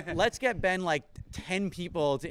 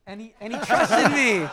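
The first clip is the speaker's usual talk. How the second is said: much higher, laughing exclamation; loud mock anguish; 8 semitones higher, 12 dB louder than usual. Real, not acted. Host confessing to the crowd that his guest trusted him, in loud mock anguish. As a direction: cry it out in comic guilt.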